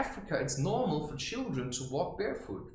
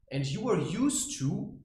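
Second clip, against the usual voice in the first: ordinary delivery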